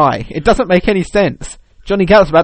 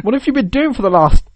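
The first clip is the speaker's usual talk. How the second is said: incredulous colleague, acted; 8 semitones higher, 4 dB louder than usual. Acts colleague challenging lazy developer.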